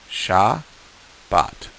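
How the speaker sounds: syllable by syllable, sha then bat; slow sounding out. Shown as a demonstration. Teacher sounding out a word slowly for students. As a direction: split it into two beats with a gap. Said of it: "Say that with me".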